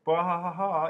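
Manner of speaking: hammed-up evil laugh, high and loud; mock villain cackle. Reading out a viewer's username with a hammed-up evil cackle.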